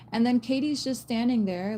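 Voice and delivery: ordinary talk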